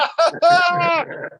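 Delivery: high, bursting cackle of amusement; hearty laugh. Real, not acted. Laughing at co-host's joke about being hated, hearty high laugh. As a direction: crack up out loud.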